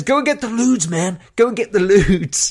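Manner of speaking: high urgent mock demand; hammed-up party yell for the drugs; imitating Jordan. Friend quoting Jordan in the storm, high mock urgency. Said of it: "And he says".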